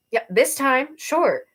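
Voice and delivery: high, loud scoffing sure; sarcastic. Acted. Arrow mocking Badge's glitch excuse, sarcastic and loud.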